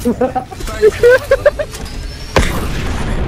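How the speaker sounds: laughing at his own slip; high laugh. Real, not acted. Laughing at near mistake.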